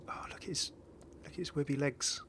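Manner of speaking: breathy hushed aside; awed little whisper to the viewers. Real, not acted. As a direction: lean in and whisper it. Admiring a skin's details.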